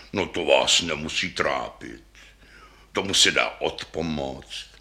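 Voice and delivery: low, soothing character voice; reassuring bass. A narrator's reading. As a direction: calmly brush the worry away.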